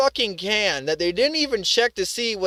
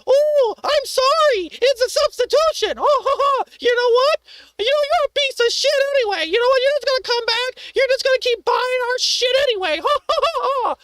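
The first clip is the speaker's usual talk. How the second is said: shrill-free but much higher mocking customer service voice; mocking store clerk; 14 semitones higher than usual. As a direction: ham up a smug store employee taunting the customer. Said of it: "consider it".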